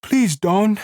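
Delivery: Lenny begs the screaming woman, pleading high; begging. A narrator's reading. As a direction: beg her to stop, voice rising. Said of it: "he begged".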